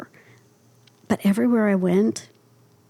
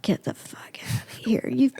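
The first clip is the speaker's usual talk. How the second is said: breathless whispered disbelief; whispered amazement; stunned whisper; 3 semitones higher, 5 dB quieter than usual. Retold or imitated. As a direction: hush it like you can't believe it. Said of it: "he goes".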